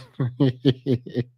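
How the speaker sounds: low, breaking giggle; amused laugh. Real, not acted. Reacting to grown man treated like her son, amused laugh. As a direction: laugh helplessly at your own joke. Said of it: "I'm laughing".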